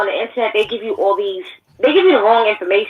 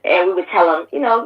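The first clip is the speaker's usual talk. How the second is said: ordinary talk, nothing marked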